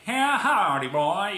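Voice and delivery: loud, high American country holler; mock American country drawl, belted out; Applejack, American country accent. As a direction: exaggerate a rural American twang, loud and high. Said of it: "Applejack in the American version is like".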